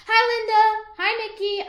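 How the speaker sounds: loud, high friendly call; child greeting the neighbors over the fence, calling out loud. Acted. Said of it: "I called to the".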